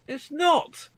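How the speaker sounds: high, squeaky insistence; mock-indignant denial. Real, not acted. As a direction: insist in a high voice, playfully outraged. Playful back-and-forth quarrel.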